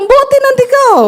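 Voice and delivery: high, laughing teasing jab; half-laughing mock insult. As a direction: tease him with a squeal and a laugh. Man teasing another man.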